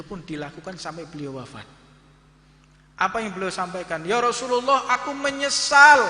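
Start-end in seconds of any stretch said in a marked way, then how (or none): 4.0-6.0 high, slow, anguished cry of regret; regretful wail. Acted. Companion confessing to the Prophet, a high slow anguished wail. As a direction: wail it out like a repentant man at the Prophet's feet.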